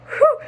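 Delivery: relieved whew. Real, not acted. Recovering after interview.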